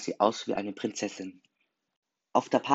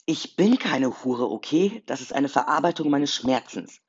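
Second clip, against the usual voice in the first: raised, louder defensive retort; indignant protest; 4 semitones higher, 6 dB louder, slower than usual. A narrator's reading. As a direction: protest emphatically, pitch climbing. Jason protests against Sarah's accusation. (empörter Protest mit erhobener Stimme)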